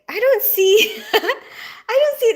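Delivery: high, giggling imitation of confused viewers; mock confusion. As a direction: play the puzzled chat laughing through it. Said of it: "everyone was like".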